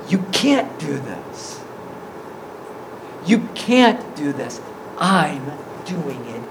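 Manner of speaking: low, soft and deliberate; hushed, earnest assurance. Acted. Jesus telling the sinner who does the work, hushed assurance.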